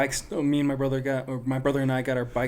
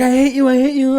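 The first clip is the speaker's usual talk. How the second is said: high, loud tantrum yell at dad; retold kid's scream; 12 semitones higher, 9 dB louder than usual. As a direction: shriek it like a kid being dragged by the ear. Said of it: "oh, I'm screaming".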